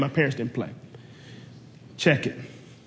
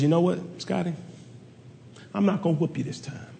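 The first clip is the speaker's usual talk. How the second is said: softer, gentle mother's mercy; mama quietly sparing him, softer; calm mom; 8 dB quieter than usual. Retold or imitated. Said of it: "Mama says".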